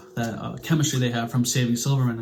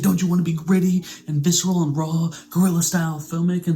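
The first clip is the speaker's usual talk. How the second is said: higher, louder mock artsy pitch; passionate pitch; 6 semitones higher, 4 dB louder than usual. [name] pitching gritty filmmaking to the couple, mock eager. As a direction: sell it like an eager indie filmmaker.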